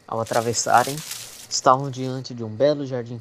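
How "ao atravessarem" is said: ordinary delivery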